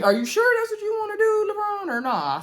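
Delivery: high-pitched doubting question; mock skeptical questioning. Recapper questioning a contestant's tentative answer, playfully skeptical. Own skeptical reaction, acted out.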